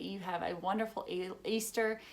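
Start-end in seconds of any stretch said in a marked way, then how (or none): none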